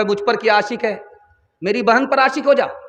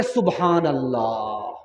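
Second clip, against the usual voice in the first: low, slow, drawn-out praise; contented sigh; 10 semitones lower, 6 dB quieter, much slower than usual. Acted. Daughter answering her mother, slow and content.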